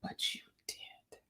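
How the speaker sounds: slow breathy whispered aside; teasing stage whisper. Pretend. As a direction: lean in and whisper the punchline. Teasing aside after the song.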